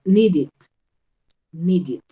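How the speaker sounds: NEEDED said as "nee-did"; ID ending. Shown as a demonstration. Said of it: "I D, ¿cierto?".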